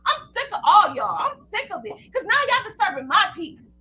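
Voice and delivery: ordinary talk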